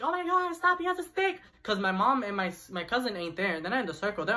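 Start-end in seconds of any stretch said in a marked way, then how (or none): 0.0-1.4 much higher, panicked cry; alarmed neighbors. Retold or imitated. Neighbors see the stick and cry out, high and alarmed. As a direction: sound the alarm at the hidden stick. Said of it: "all my neighbors are like".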